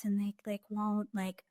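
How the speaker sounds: soft, held-back mumbling imitation; imitating unconfident candidate. Recruiter imitating a candidate on video, unconfident and quiet. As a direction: shrink the voice, mimicking a timid applicant. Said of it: "they're, like, talking like this".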